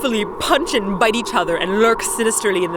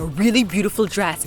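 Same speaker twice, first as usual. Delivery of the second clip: usual speaking voice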